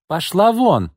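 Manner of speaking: громкий крик; shouted. A narrator's reading. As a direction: throw her out with a yell. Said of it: "крикнула Изольда".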